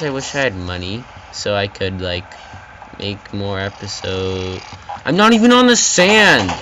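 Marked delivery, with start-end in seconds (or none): 5.0-6.5 high, loud indignant yell; outraged shout. Real, not acted. Gamer outraged at the game, loud and high. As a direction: protest loudly at the unfair game.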